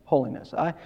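normal talking voice

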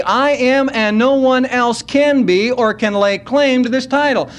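ordinary talk, nothing marked

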